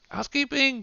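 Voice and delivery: high, sing-out housekeeping call; hotel maid calling out. Acted. Hotel maid announcing herself to a guest, high-pitched call.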